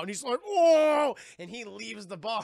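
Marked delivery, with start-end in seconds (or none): 0.5-1.2 high, very loud "oh"; hammed-up howl of distress; mock anguished yell. Larry about to flee the bar, mock anguished yell.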